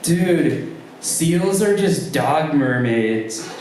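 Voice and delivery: lightly voiced stoner, slow on the punchline; stoned friend. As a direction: drawl it like a tripping buddy. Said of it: "fountain of wisdom include".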